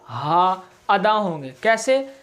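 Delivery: ordinary talk, nothing marked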